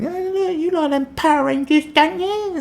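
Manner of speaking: very high, loud mum voice; mock mum. Mum fobbing her kid off with knockoff toys. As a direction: coo it like a patronising mother. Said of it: "your mum going".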